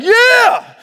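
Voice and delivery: high, loud whoop of agreement; imitated crowd shout; congregation's cheer. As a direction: holler back like a fired-up congregation. Imitating responsive urban church crowd.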